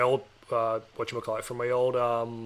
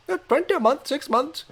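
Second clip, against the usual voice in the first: put-on high-pitched Indian-accented confirmation; imitating an Indian-accented salesman, pitched up; 11 semitones higher than usual. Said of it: "I'm doing an Indian accent".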